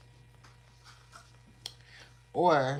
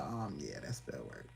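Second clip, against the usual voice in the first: whisper, dropping very low and soft; secretive whisper about spell work; whispered aside; 9 semitones lower, 10 dB quieter than usual. Pretend. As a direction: lean in conspiratorially. Hushed aside.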